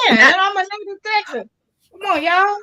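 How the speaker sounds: high, laughing disbelief; laughing protest. Real, not acted. Mock protest at being told no.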